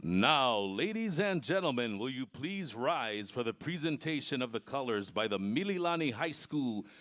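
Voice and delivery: booming PA voice; echoing stadium announcer. Real, not acted. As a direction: announce it over the stadium speakers. PA announcer introduces anthem.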